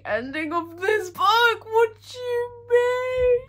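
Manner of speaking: high, slow tearful voice breaking; crying. Real, not acted. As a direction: voice cracking with tears over the ending. Emotional reaction to book ending.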